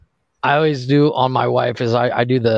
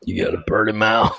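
Deliverance line; 7 semitones lower than usual. Retold or imitated. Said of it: "he did that one".

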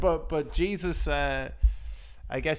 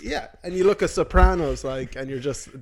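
ordinary delivery

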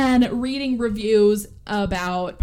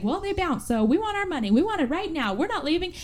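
insistent demand; 7 semitones higher than usual. Retold or imitated. Acted standoff over bounced checks.

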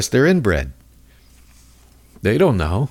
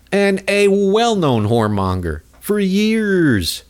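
ordinary talk, nothing marked

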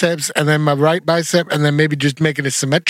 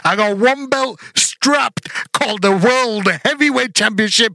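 sneering wrestling villain; 4 semitones higher, slower than usual. Pretend. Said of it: "Do you wanna be heel?".

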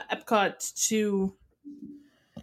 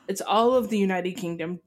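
normal talking voice

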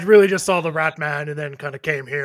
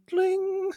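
mouthed dling. Acted. Mouth-made lute strum.

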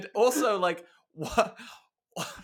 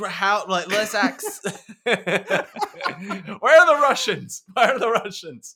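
tipsy shouting; 6 semitones higher, 8 dB louder, slower than usual. Retold or imitated. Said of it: "They're like".

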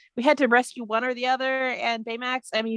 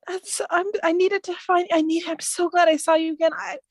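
high, emotional, stumbling gladness; heartfelt reassurance; 7 semitones higher than usual. Acted. Hava comforts a broken friend, high and heartfelt. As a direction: pour out relief in a raised, earnest voice.

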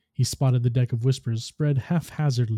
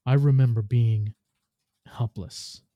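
words catching in throat. A narrator's reading. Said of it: "The words caught in his throat".

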